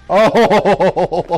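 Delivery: sudden loud startled Oh; startled shout. Real, not acted. Gamer startled by a killer on screen, loud yelp. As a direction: yelp in surprise.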